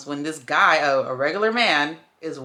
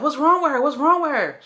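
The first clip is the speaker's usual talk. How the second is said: higher, louder worried passerby; concerned passerby; 10 semitones higher, 5 dB louder than usual. Retold or imitated. Passerby who pulled over asking the boyfriend, alarmed. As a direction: voice the stranger asking in alarm, pitched up. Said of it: "They was like".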